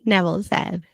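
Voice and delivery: plain reading voice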